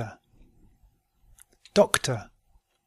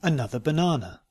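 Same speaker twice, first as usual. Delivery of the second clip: "Another banana" run together quickly; faster than usual. Shown as a demonstration. Teacher repeating the practice sentence, quickly.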